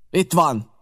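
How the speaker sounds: angry pirate shouting after spitting; shouted. A narrator's reading. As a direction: fling the words at the blockhouse. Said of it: "kiáltotta".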